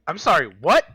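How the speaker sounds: loud stunned what; shocked disbelief. Retold or imitated. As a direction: blurt it out in shock. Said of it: "she just dropped that on me like".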